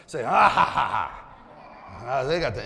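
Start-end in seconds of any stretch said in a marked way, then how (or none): 0.2-1.3 loud fake laugh, falling at the end; drawn-out show-off "ha ha ha"; mock haughty laugh. Shown as a demonstration. Preacher models for the influential section, mock haughty laugh. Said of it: "the ones that have influence".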